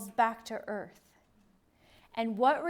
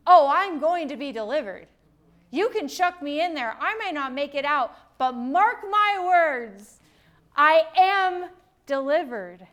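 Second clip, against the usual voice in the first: loud, triumphant, slowing on mark my words; bold defiance; 2 semitones higher, 7 dB louder than usual. Pretend. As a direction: declare it like a man who fears nothing. Boys' defiant faith voiced.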